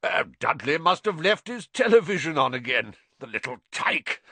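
hearty uncle calling through the house; a blustering man calling. A narrator's reading.

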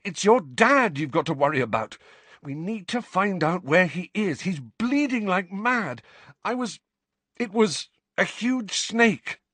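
high, urgent, breaking off mid-thought; frantic insistence. Acted. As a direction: plead desperately to be believed. Harry frantically insists, shaking, retching.

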